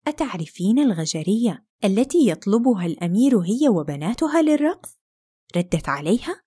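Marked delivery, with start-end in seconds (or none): none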